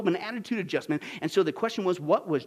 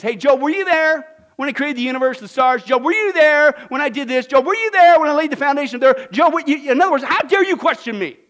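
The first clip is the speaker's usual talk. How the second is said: loud, high, thundering interrogation; God challenging Job; 6 semitones higher, 10 dB louder than usual. Acted. God confronts Job with questions, thundering. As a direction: demand answers as an indignant God. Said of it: "the question is like this".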